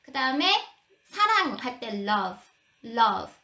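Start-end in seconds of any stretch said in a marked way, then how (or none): 1.1-3.3 love said with a short open uh, mouth barely open; love with an uh vowel. Shown as a demonstration. Teacher demonstrating love with an uh sound to parents. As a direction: say love as lʌv, jaw relaxed. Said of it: "입 크게 안 벌렸죠? 어 발음이에요".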